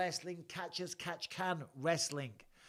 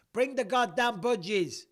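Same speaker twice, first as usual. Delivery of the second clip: calling out; 8 semitones higher, 8 dB louder than usual. Real, not acted. Calling to his wife offscreen.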